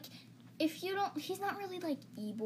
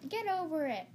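higher, mock suburban dismissal; suburban type; 5 semitones higher than usual. Shown as a demonstration. Showing how suburban people would say it, mock dismissive. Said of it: "in, like, suburban areas".

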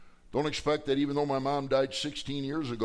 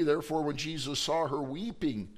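ordinary talk, nothing marked

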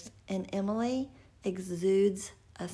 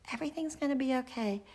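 high, fast reassurance; a mother repeating comfort at midday; reassuring again; 5 semitones higher, faster than usual. Retold or imitated. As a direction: tell them lightly once more.

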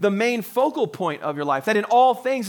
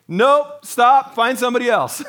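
louder, higher blunt refusal; mock refusal; 5 semitones higher, 6 dB louder than usual. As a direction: push them away in a raised voice. Mock refusal Mary never gave.

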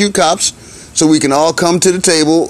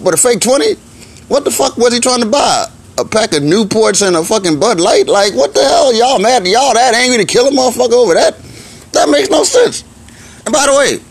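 incredulous outburst; 9 semitones higher than usual. Real, not acted. Exasperated rant at the cops.

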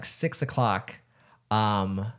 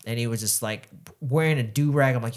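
ordinary talk, nothing marked

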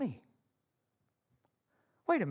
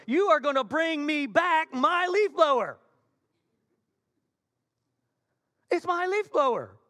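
loud, raised, emphatic insistence; indignant demand; 6 semitones higher, 6 dB louder than usual. Acted. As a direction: demand it back with mock outrage. Acted demand for blower back.

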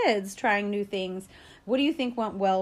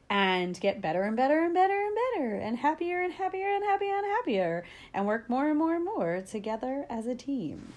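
rising enthusiasm; 4 semitones higher than usual. Real, not acted. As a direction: pitch climbing higher through the repeats. Building growth, better and better.

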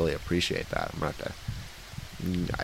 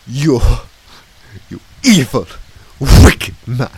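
louder and louder, higher; loud outraged insult; 4 semitones higher, 15 dB louder than usual. Acted. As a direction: spit out the insult in fury. Clown keeps cursing.

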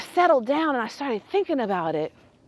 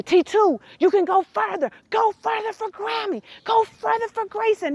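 higher, eager encouragement; cheering her on; 7 semitones higher than usual. Retold or imitated. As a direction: urge her to go further. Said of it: "I could say".

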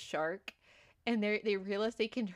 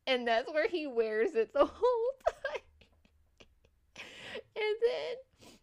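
higher, louder, cracking up mid-sentence; real laughing; 12 semitones higher, 3 dB louder than usual. Real, not acted. Telling co-host about the shark's hat, cracking up. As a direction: voice climbing into helpless laughter.

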